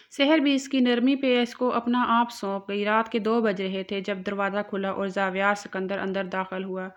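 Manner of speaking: plain reading voice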